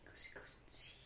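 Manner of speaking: hushed whispered guess. Real, not acted.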